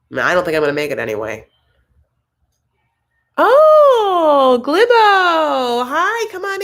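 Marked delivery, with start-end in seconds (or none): 3.4-6.6 much higher, louder sing-song hello; excited greeting. Real, not acted. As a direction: gush hello to newcomers like a host. Greeting a viewer joining the live.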